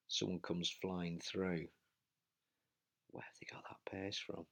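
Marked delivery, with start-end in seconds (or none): 3.1-4.4 breathless whisper. Real, not acted. Gasping rider mid climb.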